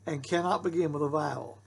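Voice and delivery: cannot hit firmly, falling at the end; stress on cannot. Shown as a demonstration. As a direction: lean on the word cannot. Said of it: "So always remember that".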